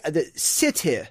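"city" as sih-tih; short-I "city" demonstration; K K pronunciation. Shown as a demonstration. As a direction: end "city" on a short I with a crisp T. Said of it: "K K".

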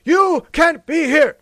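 loud, high mock yell; shouted airspace warning; yelling pilots. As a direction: bark the warning like a radio order to leave. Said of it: "coming out and yelling".

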